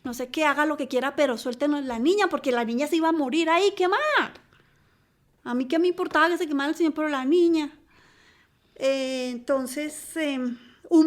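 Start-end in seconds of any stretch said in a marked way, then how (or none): 0.0-4.0 raised, urgent begging, louder and higher; retold pleading cries. Mother and maid begging for the child's release, retold pleading cries. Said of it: "yo gritaba, la empleada también". 5.4-7.7 rushed, raised voice, higher and louder; retold pleading cries. Mother caring only about saving her child, retold frantic cries. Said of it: "yo gritaba".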